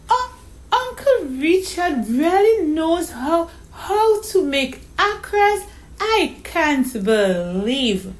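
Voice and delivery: put-on high, slow child's disbelief; small girl overwhelmed with wonder; amazed little girl. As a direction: stammer and stretch words in amazement. Voices young Donna May in story.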